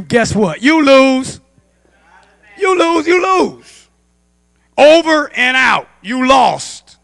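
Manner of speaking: loud, high shouted verdict; full-force preaching. Real, not acted. Preacher warning congregation they lose against demons, full-force preaching. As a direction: drive each short phrase home, loud.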